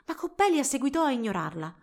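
ordinary delivery, nothing marked

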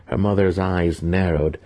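usual speaking voice

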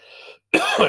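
breathy cough; chesty cough. Real, not acted. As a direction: cough without voice. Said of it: "Sorry for the coughing".

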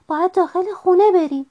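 high-pitched urgent call; frightened cry. A narrator's reading. Franklin to fox as the storm breaks, high cry. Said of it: "فرانکلین فریاد زد".

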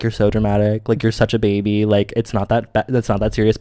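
soft, low dismissive teasing; unimpressed parents, played down low; mocking parents. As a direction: brush it off under your breath. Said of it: "always make fun of me".

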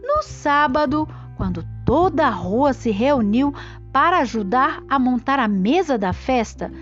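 ordinary delivery

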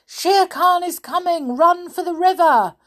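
high, loud warning shout; alarmed call. Acted. Mowgli warns the elephants, loud alarmed shout. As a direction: yell the warning like the tiger is near. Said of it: "called Mowgli".

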